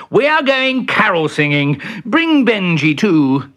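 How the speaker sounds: high, loud and eager; eager announcing. Acted. Bertie inviting Peter carol singing, high eager voice. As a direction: announce the plan with gusto.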